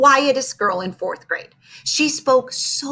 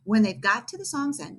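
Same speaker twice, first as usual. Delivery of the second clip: plain reading voice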